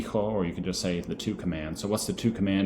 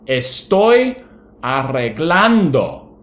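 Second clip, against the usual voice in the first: put-on much higher and louder kid's voice; hammed-up kid protesting to dad; child answering; 8 semitones higher, 12 dB louder, much slower than usual. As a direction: whine back that you're already cleaning. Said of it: "You could say".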